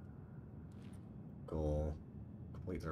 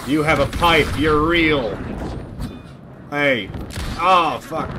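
shouting much higher and louder in real panic; panicked yelling; 10 semitones higher, 17 dB louder, slower than usual. Real, not acted. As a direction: yell in fright as the enemy closes in. Panicked fight with enemies.

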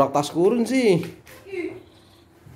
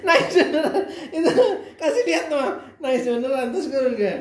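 higher, words broken by real laughter; laughing; 10 semitones higher than usual. Real, not acted. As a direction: laugh through it, can't hold it in. Father laughing at crying kid.

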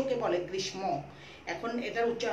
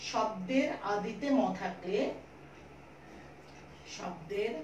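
slow, word by word, with long pauses; slow dictation; 5 dB quieter, slower than usual. Shown as a demonstration. Teacher dictating a rule to pupils, slow and deliberate. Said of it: "ভালো করে বুঝে নাও আমি কি বললাম".

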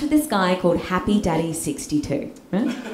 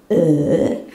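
low, drawn-out mock burp; groaned-out burp noise; 4 semitones lower than usual. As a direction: imitate a long belch. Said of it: "coffee makes me burp".

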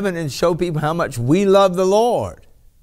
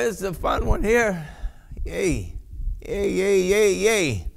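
usual speaking voice

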